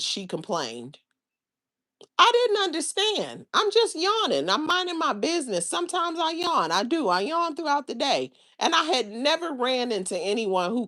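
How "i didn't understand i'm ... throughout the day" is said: high-pitched, protesting innocence; plaintive self-defence. Retold or imitated. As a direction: plead your innocence in a much higher voice. Defending her yawning against coworker's complaints.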